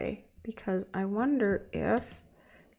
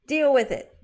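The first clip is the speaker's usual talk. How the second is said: louder, higher mock sass; sassy louder deal with it; playful defiance; 6 semitones higher, 6 dB louder than usual. Real, not acted. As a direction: toss it off cheekily.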